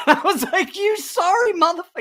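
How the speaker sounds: squeaky laugh through the words; can barely get it out for laughing; cracking up at the memory. Real, not acted. Laughing while retelling.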